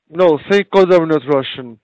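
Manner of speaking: usual speaking voice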